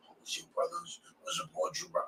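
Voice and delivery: half-hearted mock encouragement, very quiet; mock passing supporter. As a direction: mimic a token greeting under your voice. Acting passerby's token support in passing.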